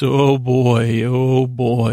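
ordinary talk

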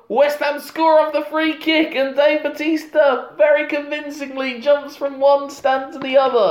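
high, sustained commentator cry; excited commentary. Acted. Commentator calling the goal and the jump, hammed-up excitement. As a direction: hold it up high like a goal call. Mimicking excited match commentary over film action.

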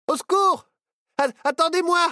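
very high, loud cries for help; a sinking boy yelling for rescue; screaming for help. Acted. Nao sinking in the bog calls the others, screaming for help. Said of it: "hurla Nao".

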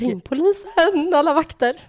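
high, slow, hammed-up cry for help; mock panicked call. Joking she'd be beaten up, mock panic slow and high. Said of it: "Så eldig".

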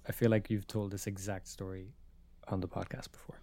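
hushed whispered aside. Real, not acted. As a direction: drop to a breathy whisper to finish. Aside said under breath.

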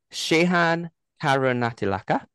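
high, careful Sri Lankan name in a Geordie accent. Shown as a demonstration. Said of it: "Perfectly pronounced in my Geordie Sri Lankan".

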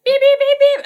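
high, loud, rapid beeping; imitated scanner beeps. Setting off the airport security scanner, mimicked alarm beeps.

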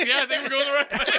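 laughing through the words; giggling while speaking. Pretend. Mock quoting the pilot.

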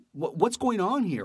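high, slow, stunned question; shocked disbelief. Retold or imitated. Hearing a runner overdosed, high and stunned.

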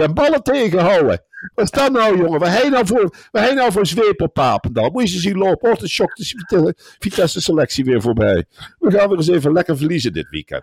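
high-pitched, scornful tirade; mocking rant. Real, not acted. Pundit on air mocking the coach, high-pitched scornful rant. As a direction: pour pure scorn on the coach.

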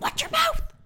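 very high, loud indignant outburst; mock outrage. Customer scolding the cashier, outraged high yell. Acted snap at indiscreet cashier.

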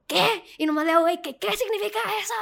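high, fast, stunned question; retold shock at being pointed at; stunned disbelief. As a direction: blurt it out in disbelief. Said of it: "Y yo".

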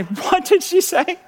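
high, slow, incredulous squeak; retold stunned disbelief at the nurse. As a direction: gasp out the question in shock. Shocked question about placenta.

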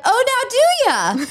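high, drawn-out mock surprise; acted-out "well, well" reaction; teasing intrigue. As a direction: stretch it out high, playfully nosy. Acted teasing reply to coworker.

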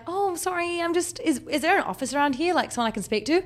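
high, flustered apologetic voice; flustered apology. Retold or imitated. Apologising to the gardeners, high and flustered.

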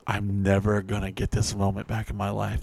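deadpan muttered misery; stuck with a bore at a party; inner despair. Retold or imitated.

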